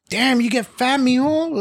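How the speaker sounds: high mock auntie, teasing; teasing relative. Imitating a relative teasing about weight. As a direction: needle him like a nosy aunt. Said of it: "your family, your cousins".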